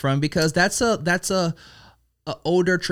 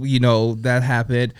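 usual speaking voice

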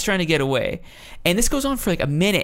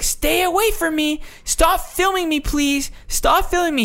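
high-pitched mock-panicked woman; freaking-out woman; 14 semitones higher than usual. Woman yelling at the man filming her, mock freak-out. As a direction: imitate her losing it, pitched way up. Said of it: "losing her shit".

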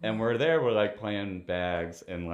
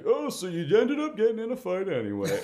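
mocking dad voice; 11 semitones higher, 5 dB louder than usual. Dad mocking retold fight.

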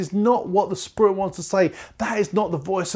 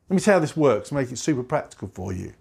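normal talking voice

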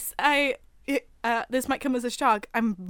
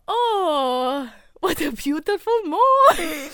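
drawn-out, higher, giggly Irish attempt; bad Irish accent; 4 semitones higher, 7 dB louder, much slower than usual. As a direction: sing out a terrible Irish accent, breaking into giggles. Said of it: "I nailed the Irish accent".

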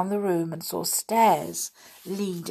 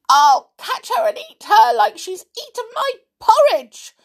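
very high, loud cub voice; Little Bear's squeak; 8 dB louder than usual. A narrator's reading. Little Bear tumbling after Goldilocks, squeaking. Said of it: "squeaked Little Bear".

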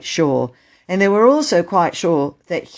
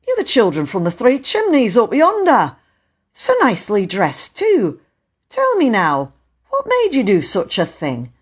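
lightly voiced official, somewhat raised; puzzled, reproachful questioning of children; reproving station master; 8 semitones higher, 4 dB louder than usual.

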